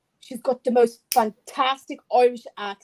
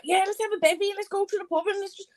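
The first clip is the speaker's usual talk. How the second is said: higher, rowdy mock Scouse pub lad; 8 semitones higher, faster than usual. Mocking a rough Scouser picking a fight in a higher mock Scouse voice. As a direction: build to a quick aggressive Scouse challenge. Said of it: "like, a Scouser person".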